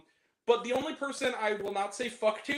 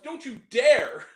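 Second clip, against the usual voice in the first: slow, drawn-out higher warning; mock threat to the filmmakers; 7 semitones higher, 3 dB louder, slower than usual. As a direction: stretch out each word as a threat, laughing. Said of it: "that was my reaction".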